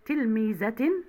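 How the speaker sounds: تلميذة with a -tin ending; تنوين بالكسر. Shown as a demonstration. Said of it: "بالتنوين بالكسر". (نطق تلميذة بكسرتين في آخرها)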